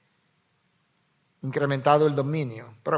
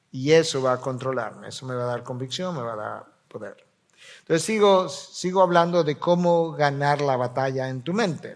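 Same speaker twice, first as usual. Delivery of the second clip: ordinary delivery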